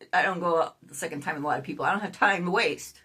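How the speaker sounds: ordinary delivery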